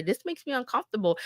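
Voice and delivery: normal talking voice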